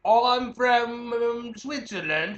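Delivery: slow, high, put-on foreign accent; hammed-up European accent; goofy foreign accent, drawn out; mock foreign accent. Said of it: "no, the other one".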